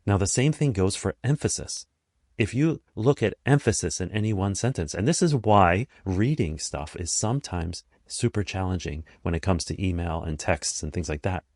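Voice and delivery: ordinary talk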